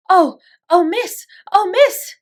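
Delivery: high, loud breathless cries of "Oh, miss"; a scared maid stammering for mercy; frightened stutter. A narrator's reading. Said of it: "she stuttered".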